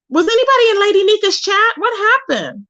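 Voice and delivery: real alarm, pitch shooting up; shocked. Real, not acted. Hearing chat mention a gun, shocked and high.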